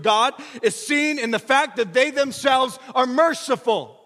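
high, loud pulpit delivery; fervent sermon climax; full-force preaching. Acted. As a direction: preach it out to the whole room.

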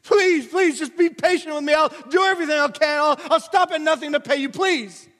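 high, loud pleading, rushing the promises; begging servant. Retold or imitated. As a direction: beg the master desperately, please after please. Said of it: "He's begging him".

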